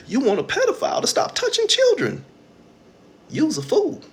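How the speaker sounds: high exasperated voice; incredulous scold. Real, not acted. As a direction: hammer the absurdity. Scolding the audience.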